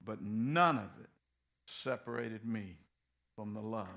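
low, soft, fervent sermon close; hushed earnest preaching; quiet intense preaching. Acted. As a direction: bring it down to a hushed conviction.

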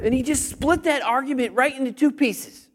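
usual speaking voice

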